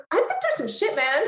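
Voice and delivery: high, laughing exclamation; laughing disbelief. Retold or imitated. Recalling therapy with her therapist, laughing in disbelief.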